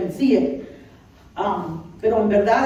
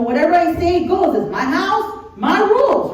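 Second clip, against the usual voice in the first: loud, high, emphatic decree; self-important king; 8 semitones higher, 4 dB louder than usual. Acted. Preacher voicing David as absolute ruler, self-important king. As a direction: lay down the law, mock bossy. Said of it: "pues, él era el rey".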